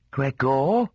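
gentle questioning call with a rising end; mother's tentative, lilting call; soft-voiced mother. A narrator's reading. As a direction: call his name as a mother checking through a door. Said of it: "What a soft voice!".

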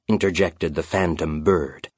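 ordinary delivery, nothing marked

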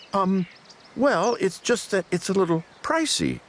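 high, hesitant, sheepish hedging; timid, faltering objection; nervous stammer. A narrator's reading. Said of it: "I stammered".

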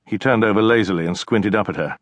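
ordinary delivery, nothing marked

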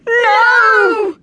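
put-on high, loud children's refusal; yelling. A narrator's reading. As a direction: yell it like a fleeing class. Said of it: "yelled the children".

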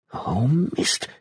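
growled under breath. A narrator's reading. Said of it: "knurrte sie noch einmal".

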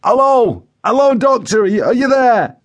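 high, urgent calls down the line; Joe Windass calling out. A narrator's reading. Joe checks the paramedic is still there, calling out high. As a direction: call anxiously for someone who's gone silent. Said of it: "hear Joe's voice faintly down the line".

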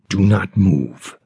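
low warning through gritted teeth. Acted. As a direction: clench the jaw and growl the word. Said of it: "he said through gritted teeth".

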